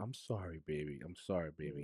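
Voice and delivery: put-on soft, low, kneeling apology to a child; hushed and tender, like comforting a little girl. Father kneeling by the bath, softly apologizing to his daughter. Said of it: "I'm telling her".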